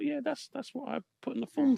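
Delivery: retold own reply. The author replays his answer to Eunice's question, high and soft. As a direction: pitch it up like a naive younger self.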